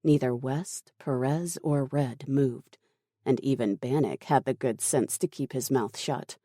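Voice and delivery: usual speaking voice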